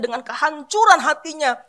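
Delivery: normal talking voice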